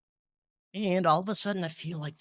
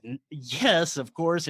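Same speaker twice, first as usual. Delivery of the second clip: ordinary talk, nothing marked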